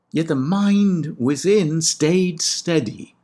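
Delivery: slow, high, solemn line; poetic recitation. Shown as a demonstration. Lecturer declaiming the key Homer line, slow and high. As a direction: let mind ring out, drawn slow.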